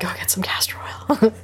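hushed unvoiced whisper. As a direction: whisper it conspiratorially. Continues whispered advice.

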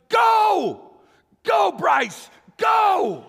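full-voiced high yell of go; shouting from the shore to his exhausted buddy; shouted encouragement. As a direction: bellow it across the beach to a struggling friend. Coach yelling to buddy.

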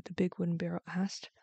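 plain reading voice

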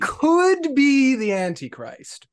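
slow, high and loud dramatic reveal; ominous. Pretend. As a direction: announce it like a dark prophecy. Dramatic reveal of joke theory.

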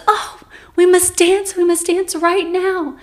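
high, loud, eager exclamation; acted excited urging. Dream man urges her to dance at once, acted loud excitement.

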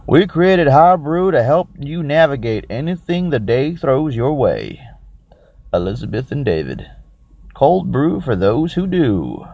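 badly faked Texan ad-copy voice; shitty Texan accent. Drink reviewer reading the can's blurb, in a mock Texan accent. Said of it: "do it in my shitty, uh, Texan voice".

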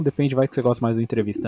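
ordinary talk, nothing marked

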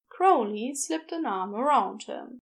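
usual speaking voice

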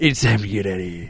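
put-on goofy comedian voice; Adam Sandler. Podcaster as Adam Sandler clearing a stage, hammed-up impression. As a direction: keep the silly impersonation going to the end. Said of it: "I wanted Adam Sandler to jump on his stage and be like".

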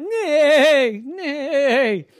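high, loud horse whinny of words; horses neighing. Acted. Horses neigh in bedtime story.

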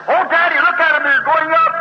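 put-on boy sees the death angel pass; excited child. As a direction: exclaim like an amazed little boy. Child voiced in story.

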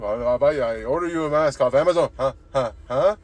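loud, pushy mock-accent offer; hammed-up eager coworker impression; imitated Mexican coworker. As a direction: insist loudly, then hammer rising huhs. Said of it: "He keeps asking me".

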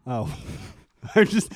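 ordinary delivery

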